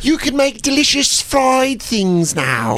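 high, slow sassy caricature; sassy woman voice. Acted. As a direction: ham up a sassy lady. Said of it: "they were like".

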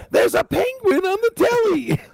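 very high, piping British lady voice; Monty Python lady. Retold or imitated. Host mimicking a Monty Python housewife, very high. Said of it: "when you do your mom's voice".

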